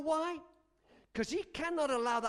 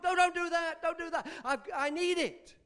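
raised, high-pitched begging; desperate pleading; 7 semitones higher, 4 dB louder than usual. Acted. Drinker to friend pouring away his alcohol, desperate pleading. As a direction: plead urgently, repeating yourself.

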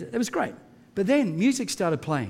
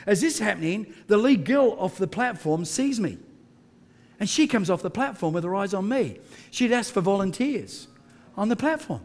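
ordinary delivery

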